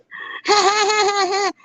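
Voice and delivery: high squealing laugh; shrill cackle. Retold or imitated. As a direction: cackle like a giddy woman. Mimicked cackle.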